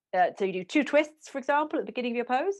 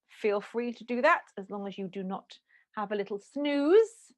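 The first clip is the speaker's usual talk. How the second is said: ordinary delivery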